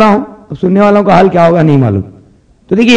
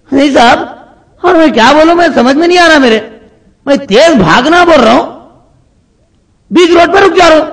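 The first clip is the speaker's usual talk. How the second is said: whining patient; 7 semitones higher than usual. Acted. Patient complaining.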